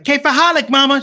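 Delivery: high, loud, eager greeting. Retold or imitated. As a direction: call it out eagerly to mom. Greets his mother in Arabic.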